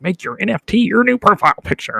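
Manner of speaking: mock notification voice. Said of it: "It was like".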